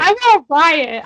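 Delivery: loud, slow mock threat; mock outrage. Host threatens to riot over Brienne, loud mock outrage. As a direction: declare it like a rioting fan.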